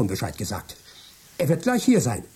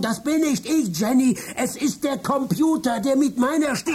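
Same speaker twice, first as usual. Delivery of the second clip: much higher, desperate protest; frantic; 11 semitones higher than usual. Acted. Trapped Michael shouting to his wife, frantic and high. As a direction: break in, panicked, to warn her. Real Michael warns Jenny.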